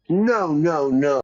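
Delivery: loud, high cries of refusal; dismayed protest. Real, not acted. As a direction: protest loudly in playful despair. Dismayed at releasing the suspect.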